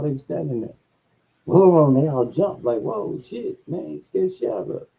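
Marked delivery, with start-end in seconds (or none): 2.8-4.9 startled bosses. Retold or imitated.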